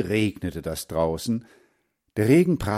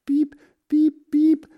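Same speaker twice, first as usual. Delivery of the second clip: very high, loud peeps; duckling peeping; 6 dB louder, slower than usual. A narrator's reading. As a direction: squeak like a little duckling. (hohes Entchen-Piepsen)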